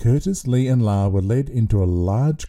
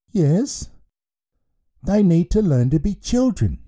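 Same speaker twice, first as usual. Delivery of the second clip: put-on raised, childlike voice of a giant baby headmaster; a cheerful baby in charge of a school; 6 semitones higher than usual. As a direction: play the baby headmaster, pitched up and welcoming.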